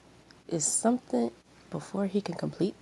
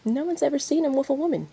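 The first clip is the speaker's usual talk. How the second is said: higher, louder, fast and gloating; smug; 9 semitones higher, 6 dB louder, faster than usual. Acted. Aino taunting Yashiro, smug and loud. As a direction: toss it out with a smug grin. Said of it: "Aino smiled smugly".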